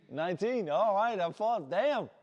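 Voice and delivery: ordinary talk, nothing marked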